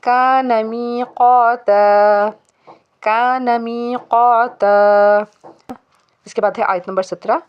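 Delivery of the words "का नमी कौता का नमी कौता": का नमी कौता recited very slowly, louder, final ता held long; का नमी कौता with every long vowel drawn out; stopping form. Shown as a demonstration. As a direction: end कौता on a stretched aa and stop. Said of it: "यहाँ पे stop करना है तो फिर पढ़ेंगे".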